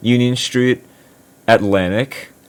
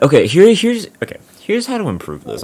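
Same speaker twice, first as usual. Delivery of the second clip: worked up; 8 semitones higher, 3 dB louder than usual. Real, not acted. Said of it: "I'm not trying to be agitated".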